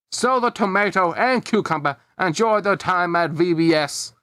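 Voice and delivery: plain reading voice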